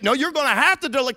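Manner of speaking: loud, fast, cut off mid-word; urgent insistence. Retold or imitated. Mary insisting Joseph deliver the baby, loud and fast. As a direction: insist over him.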